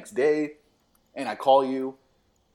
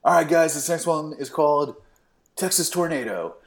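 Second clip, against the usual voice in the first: lightly voiced stage intro, a little raised; band frontman announcing; 5 semitones higher than usual. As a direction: introduce the next song to a crowd. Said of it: "So Michael goes like".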